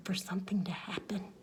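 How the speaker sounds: fast hushed whisper; suspense whisper. A narrator's reading. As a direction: breathe it out in suspense.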